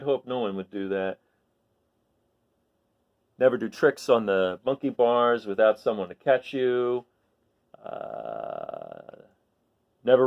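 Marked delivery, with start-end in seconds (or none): none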